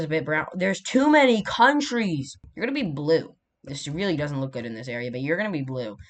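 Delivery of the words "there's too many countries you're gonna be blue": loud, high, drawn-out exasperation; exasperated outburst. Real, not acted. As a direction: cry out in overwhelmed frustration, stretching the words. Exasperated at crowded map colours.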